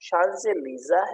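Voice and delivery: "Champs-Élysées" as shan-ze-LEE-za; correct pronunciation. Shown as a demonstration. Teacher answers what the name is, pronounced shanzeleeza. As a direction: say "Champs-Élysées" slowly with an opening sh and a z in the middle. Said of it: "ये जो right word है".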